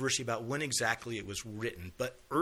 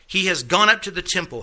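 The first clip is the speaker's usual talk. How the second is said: loud, ringing scripture reading; 12 dB louder than usual. Acted. Teacher reads Isaiah aloud to the class, loud proclaiming. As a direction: proclaim the verse loudly to the class.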